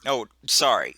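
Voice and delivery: usual speaking voice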